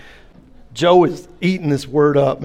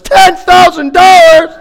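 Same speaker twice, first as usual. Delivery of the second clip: high, loud, slow outraged cry; drawn-out, much louder mock outrage; shocked shout; 13 dB louder, slower than usual. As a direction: shriek at the price. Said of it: "Well, how much is a roof?".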